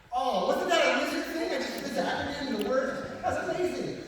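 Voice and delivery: high-pitched, put-on gushing; mock amazement. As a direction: play an over-impressed fan, tongue in cheek. Mimics impressed audience, jokingly.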